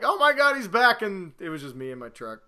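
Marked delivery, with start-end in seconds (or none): 0.0-1.0 excited. Retold or imitated.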